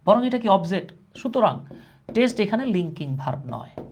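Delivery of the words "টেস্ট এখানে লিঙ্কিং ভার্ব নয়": ordinary talk, nothing marked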